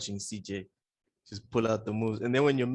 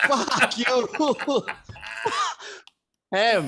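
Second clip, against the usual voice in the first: high, loud swearing through laughter; jokey curse while cracking up; laughing insult; 13 semitones higher, 6 dB louder, much slower than usual. Real, not acted. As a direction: blurt it out mid-laugh. Joking insult amid shared laughter.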